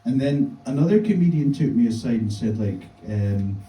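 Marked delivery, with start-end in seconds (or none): none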